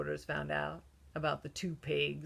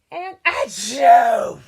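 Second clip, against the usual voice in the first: very loud slow exploding achoo; huge sneeze; 5 semitones higher, 17 dB louder, much slower than usual. Acted. Wolf sneezing at the brick house, a huge loud achoo. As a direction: blast the sneeze out.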